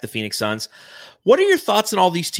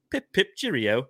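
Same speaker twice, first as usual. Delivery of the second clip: put-on British accent; 5 dB quieter than usual. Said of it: "horrible British accent".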